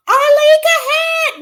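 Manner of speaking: high, slow, loud name call; excited call out. Real, not acted. Host spotting a viewer in chat, high excited shout. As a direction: shout her name with joy.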